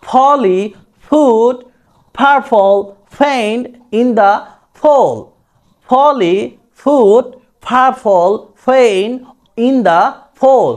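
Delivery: ফলি, ফুট, ফারফল with breathed "ph" for P, word by word; P as an aspirated F-like sound. Shown as a demonstration. Teacher modelling British P for learners, slow aspirated demo. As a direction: pronounce each P in "ফলি ফুট" like Bengali ফ, slowly. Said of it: "ফর মতো করে এর সবগুলো উচ্চারণ".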